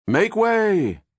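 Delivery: high chant-like shout; crowd cry. A narrator's reading. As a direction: take up the cry. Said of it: "The cry was taken up".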